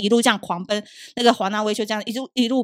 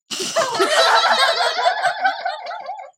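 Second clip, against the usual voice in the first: long, very high giggling; laughing. Real, not acted. Host teasing guest for looking nerdy, long high laughter. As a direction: burst into a long squealing laugh.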